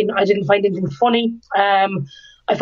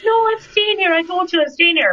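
higher, insistent mock refusal; stubborn refusal; 9 semitones higher than usual. To Shane in Dublin, a high stubborn refusal. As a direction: dig in her heels, voiced up high. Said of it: "I was like".

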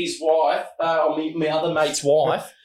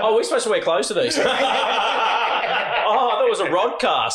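laughing, pitched up; 6 semitones higher than usual. Real, not acted. Guest cracking up over penis talk, laughing. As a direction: squeal it out between laughs.

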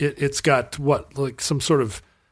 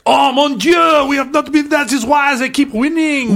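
put-on high, loud French accent; hammed-up French farmer voice; french chef; 12 semitones higher, 6 dB louder than usual. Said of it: "French leek farmers that are like".

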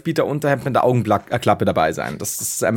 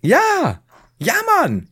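excited; 3 dB louder, much slower than usual. Real, not acted. Begeisterte Zustimmung, sehr hoch.

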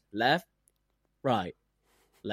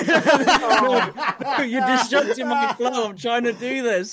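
laughing through it, pitched higher and louder; laughing protest; 10 semitones higher, 6 dB louder than usual. Real, not acted. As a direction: break into giggles while protesting. Laughing, scolding interrupting friends.

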